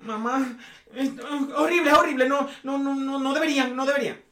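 put-on a teen telling mom the show is horrible; whiny teen. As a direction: wail it like a kid giving mom the answer she wants. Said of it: "el carajito va a decir".

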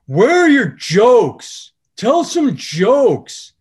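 put-on high, loud, slow drunken heckler yell. Drunk heckler at the back yells at the comedian, loud and high. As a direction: bellow it across the club, drawn out. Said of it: "a guy from the table yells out".

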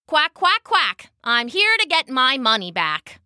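high quacking duck voice, quack jumps up high; perky duck chant; plucky duck. Acted. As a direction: chirp it out like a brave little duck.

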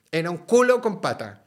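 telling a friend about the boxer boss; emphatic punchline. Real, not acted. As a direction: land the joke with gusto.

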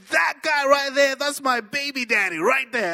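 high, insistent mock accusation; accusing mother. Woman on talk show pointing out her baby's father, insistent.

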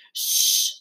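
a sharp unvoiced shh to silence the pig; hushing shh. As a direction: hush him with a breathy shh.

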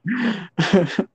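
high, helpless laughter; real high-pitched giggling fit; laughing to tears. Real, not acted. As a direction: crack up until out of breath. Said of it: "I'm crying a little bit".

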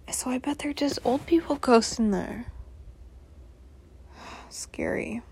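spooky hushed whisper. Real, not acted. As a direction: whisper it like a ghost story. Spooky ghost talk whispered.

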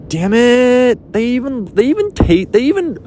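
high-pitched, hammed-up frustration; mock exasperation. Acted reaction to mushroom picture.

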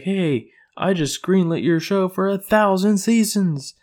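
excited announcement. Retold or imitated. Voices Satan announcing good news.

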